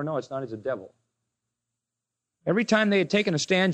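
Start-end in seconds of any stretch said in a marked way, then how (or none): 0.0-0.9 much lower and much softer, heavy; Jesus quietly condemning the Pharisees; grave quiet verdict. Acted. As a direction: drop your voice to pronounce judgment. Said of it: "Jesus says".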